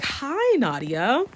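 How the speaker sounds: high, sing-song mock-friendly hello; fake sweet greeting. Elizabeth grabbing the narrator, imitated in a high fake-sweet voice. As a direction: greet her sweetly while sizing her up.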